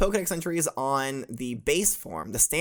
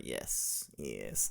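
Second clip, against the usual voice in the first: low, whispered spooky yes; eerie whisper; 16 dB quieter than usual. Pretend. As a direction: breathe it out like a ghost. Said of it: "like that".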